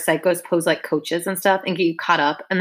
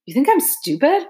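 scoffing; 9 semitones higher than usual. A narrator's reading. Boy scoffs at coach.

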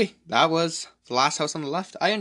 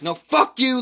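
loud, higher-pitched mock outburst; losing it, cursing; 10 semitones higher, 5 dB louder, slower than usual. Justin cursing out his dad, acted angry outburst. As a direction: imitate someone snapping and cursing back. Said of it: "he starts losing it and starts cursing out his dad".

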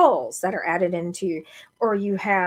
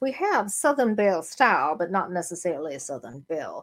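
put-on Southern belle accent. Answering the co-host's challenge, genteel Southern belle accent. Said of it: "say it in the right accent".

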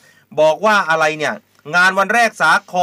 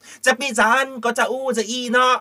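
mock northern Thai bickering; 8 semitones higher than usual. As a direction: caricature a leisurely northern Thai squabble, pitched high. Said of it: "เวลาเขาด่ากันตีกัน".

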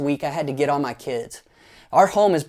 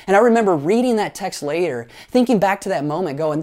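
ordinary talk

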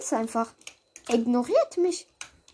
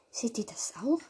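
hushed scared whisper; 6 dB quieter than usual. Real, not acted. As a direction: whisper it nervously. Scared in lobby.

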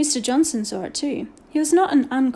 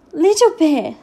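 higher, louder squeak; 5 semitones higher, 7 dB louder, slower than usual. A narrator's reading. Frightened boy answers the headmaster, squeaking it out. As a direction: squeak the name out under pressure. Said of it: "Patrick squeaked out".

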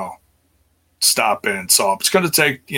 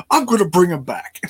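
Triple H laughing; 10 semitones higher than usual. Retold or imitated. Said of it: "Triple H would be like".

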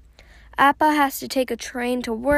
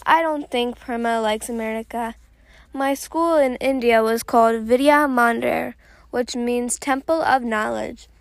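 ordinary delivery

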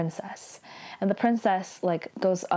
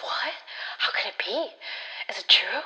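hushed, breathless murmuring; mumbling whisper; 3 semitones higher than usual. Retold or imitated. Council members murmuring in shock at the rumor, whisper. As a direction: whisper it like a shocked crowd of officials.

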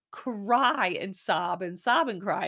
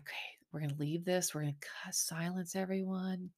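quick, breathy backstage hush, softer; hushed whisper; 10 dB quieter than usual. Retold or imitated. Crew whispering to cut the silent segment, hushed whisper. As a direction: whisper it like a crew member hushing the set.

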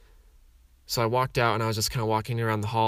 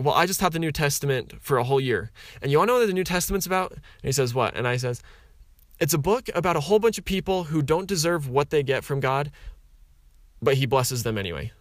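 high, louder, rushed exasperated venting; frustrated rant; 5 semitones higher, 4 dB louder than usual. Retold or imitated. Telling the institute teacher why the scriptures frustrate him, heated. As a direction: spill the grievance out fast and worked up.

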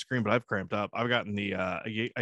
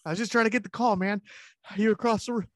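high, broken, mock-winded excuse; out of breath; 11 semitones higher than usual. Winded man explaining why he answered, mock breathless. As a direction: gasp out phrases as if puffed. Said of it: "But then I'm out of breath".

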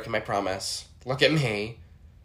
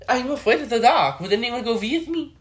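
high put-on French maid voice; Yvette impression; 10 semitones higher, 4 dB louder than usual. Yvette from Clue, scared of the dark. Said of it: "anytime I don't wanna do something".

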